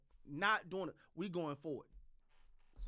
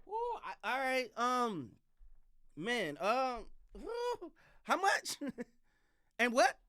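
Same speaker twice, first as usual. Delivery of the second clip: mock stunned coach, whoo squeaked much higher; imitating stunned coach; 3 semitones higher, slower than usual. Coach reacting to a huge contract offer, mock disbelief. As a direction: act floored by the offer, laughing in disbelief. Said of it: "He gonna have to be like".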